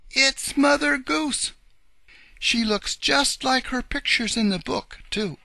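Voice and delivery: very high, loud exclamation; excited cry. A narrator's reading. Bunny uncle recognising Mother Goose from her book, excited cry. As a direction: cry it out in high-pitched amazement. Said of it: "cried Uncle Wiggily Longears".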